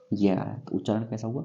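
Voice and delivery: ordinary talk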